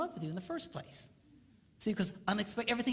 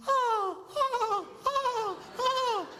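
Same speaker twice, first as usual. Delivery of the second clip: high, loud swooning ohs; delighted ohs; 3 dB louder, much slower than usual. Retold or imitated. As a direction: swoon with delight like a wife early in love. Wife delighted by unexpected kindness.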